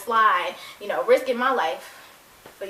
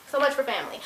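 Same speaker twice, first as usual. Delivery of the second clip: ordinary talk, nothing marked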